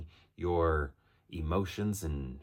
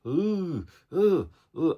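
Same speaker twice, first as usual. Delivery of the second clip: high, louder frightened ooh; scared ooh; 10 semitones higher, 6 dB louder, much slower than usual. Retold or imitated. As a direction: shudder like you're scared at a film. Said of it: "I was just like".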